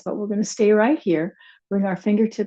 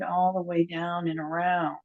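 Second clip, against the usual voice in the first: softer repeat of "all the way down and around"; quiet; 2 semitones lower, 8 dB quieter than usual. Shown as a demonstration. Teacher softly repeating the cue to the class. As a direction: let "all the way down and around" fade to a soft echo.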